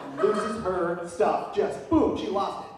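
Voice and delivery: ordinary delivery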